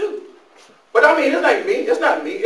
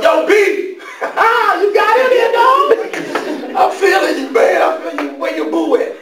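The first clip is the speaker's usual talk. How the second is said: loud, high hammed-up slang; street greeting; 8 semitones higher, 4 dB louder, slower than usual. Greeting the President like a homeboy, hammed-up loud street talk. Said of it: "talking about".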